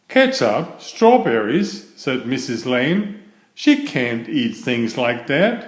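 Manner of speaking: lively read-aloud for children, voicing each character; story narration. A narrator's reading. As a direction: read it like a bedtime picture book. Reads picture book aloud.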